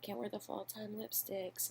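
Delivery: mock her own vanity lightly; a hint of a prissy fashionista; mock shallow voice. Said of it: "I'm like".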